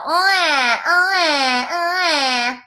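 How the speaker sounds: put-on scrunched face baby cry with tongue out; ugly forward wail, tongue held; holding tongue with fingers. Shown as a demonstration. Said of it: "scrunch your face and go".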